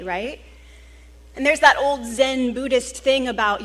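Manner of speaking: ordinary talk, nothing marked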